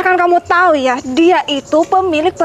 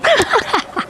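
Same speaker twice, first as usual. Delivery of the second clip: smug laugh; 4 semitones higher than usual. Acted. Boasting about being rich.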